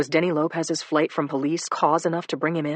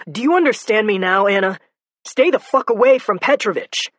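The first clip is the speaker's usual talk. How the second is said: loud, high angry order; shouting; 5 semitones higher, 7 dB louder than usual. Acted. Joe on the phone shouting at Anna to stay away, loud angry order. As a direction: shout her into staying away. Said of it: "no shouting".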